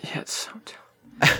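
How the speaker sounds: whispered aside, under the breath; a dismissive aside, whispered. Real, not acted. Whispering to a co-host that it's dumb.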